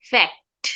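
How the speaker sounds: ফ্যাক্ট with the c said as a k sound. Shown as a demonstration. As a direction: say ফ্যাক্ট, k not s in the middle. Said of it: "আমার সিটা কি হয়ে যাবে?".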